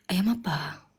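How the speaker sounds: whispered question. Real, not acted. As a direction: ask it under your breath. Whispered question about the chicken.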